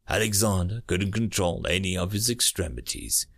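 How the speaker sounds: ordinary delivery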